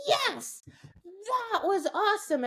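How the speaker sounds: high excited praise; praising the dog. Retold or imitated. Trainer praising her dog after the right behavior, high excited praise. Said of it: "I go".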